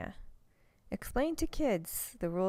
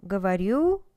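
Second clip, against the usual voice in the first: Говорю with the last syllable -рю hit louder; Говорю, final -рю stressed and loud; stress on the end; 5 dB louder than usual. Shown as a demonstration. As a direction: lean on the ending of Говорю. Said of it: "stress on the end".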